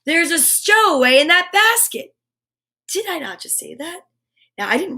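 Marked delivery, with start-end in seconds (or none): 0.0-2.1 yelling from below. Acted. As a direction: shout to stop them. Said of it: "someone yelled".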